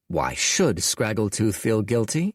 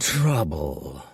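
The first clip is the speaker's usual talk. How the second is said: sighed; 6 semitones lower than usual. A narrator's reading. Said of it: "sighed Scraggletooth".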